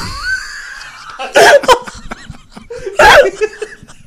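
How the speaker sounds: high, loud helpless laughter; high laughing fit. Real, not acted. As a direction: lose it completely, laughing high. Hosts losing it laughing.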